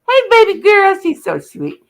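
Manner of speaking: high-pitched coo to a pet. Real, not acted. Streamer to a friend's pet, cooing. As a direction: greet the little one in a high, doting voice.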